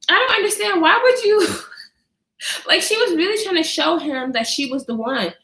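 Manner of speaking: normal talking voice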